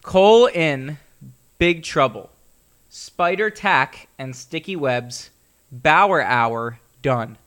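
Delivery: slow, loud, deliberate poem reading; haiku recital. Acted. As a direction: read it out like a dramatic haiku. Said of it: "So the haiku of the week".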